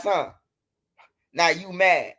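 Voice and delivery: ordinary talk, nothing marked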